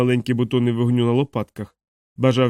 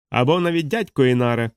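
plain reading voice

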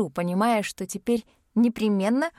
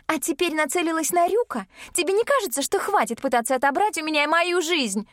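high, strained angry sister voice; voice breaking into a shout; 8 semitones higher than usual. Acted. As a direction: accuse her, voice cracking upward. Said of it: "Её голос срывается на крик".

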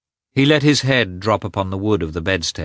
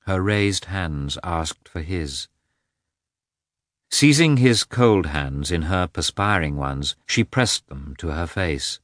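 plain reading voice

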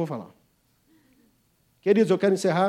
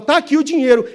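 raised voice, holding up the cash; loud declaration; 8 semitones higher, 10 dB louder than usual. Acted. Englishman waving money at the auctioneer, loudly. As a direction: announce it for all to hear.

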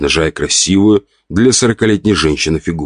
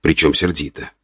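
ordinary delivery

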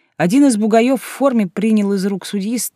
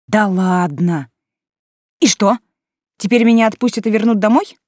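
louder, higher hopeful outburst; disbelieving excitement; 4 semitones higher, 5 dB louder than usual. A narrator's reading. Heroine's disbelieving inner reaction.

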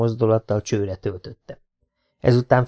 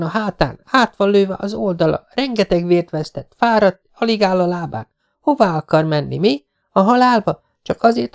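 agitated scolding; 9 semitones higher than usual. A narrator's reading. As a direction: scold him for his stubbornness. Agitated scolding of wounded man. (feldúlt, szidó hang)

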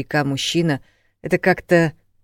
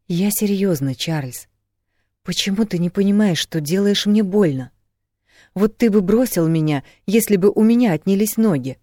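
raised, pressing, hurt appeal; earnest reproach; 5 semitones higher, 5 dB louder than usual. A narrator's reading. Woman confronting her man, earnest and reproachful. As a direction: press him hard to understand.

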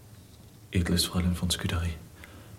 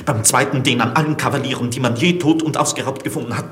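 louder and climbing higher with each degree; rising desperation; 5 semitones higher, 4 dB louder than usual. Acted. Olivier tells Scudéry what torture will force from him, rising in desperation. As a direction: build to a desperate pitch. Prisoner describes torture confessions.

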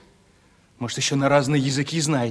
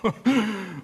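короткий смешок; 7 semitones higher, 3 dB quieter than usual. Acted. Смеётся над её словами.

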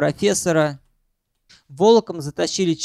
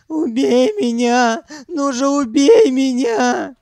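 high, slow, loud sobbing wail; howling; 8 semitones higher, 5 dB louder, slower than usual. A narrator's reading. Sanyok begging Ukhan to kill him, howling. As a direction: beg hysterically, dragging out each word. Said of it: "завыл Санёк".

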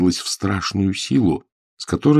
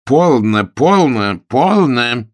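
repeated scolding; 6 semitones higher, 6 dB louder, much slower than usual. A narrator's reading. Governess keeps scolding.

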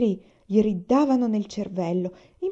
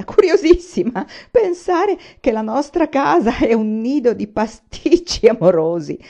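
loud, high, helpless laughing speech; half choked with laughter; 4 semitones higher, 8 dB louder, slower than usual. A narrator's reading. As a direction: laugh right through the words, louder. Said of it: "mezzo soffocato dalle risa". (ridendo forte, mezzo soffocato)

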